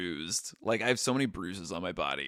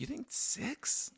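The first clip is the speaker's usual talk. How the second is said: incredulous; 7 dB quieter, slower than usual. Real, not acted. Shocked at six houses.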